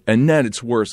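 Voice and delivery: ordinary delivery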